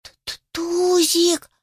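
high, stammering scared kitten; plaintive squeak. A narrator's reading. Kitten stuck up a tree calls his friend, plaintively. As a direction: squeak it out slowly, like a frightened kitten. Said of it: "жалобно запищал котёнок".

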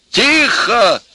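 high, loud shout for silence; worked-up shout. A narrator's reading. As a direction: yell to take over the room. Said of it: "вовсю разошёлся Максимыч".

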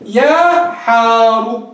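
slow sung-out call يا حار; drawn-out call. Shown as a demonstration. Teacher demonstrating a drawn-out vocative. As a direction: stretch يا حار long like calling someone. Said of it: "لو ناديت حاري ماذا تقول".

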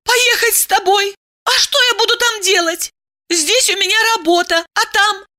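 high-pitched protesting woman; indignant Diana. Acted. Woman objects to moving with lover, indignant. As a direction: fire indignant questions up high.

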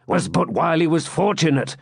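rally your friend to action; resolute swineherd. A narrator's reading. Swineherd voiced.

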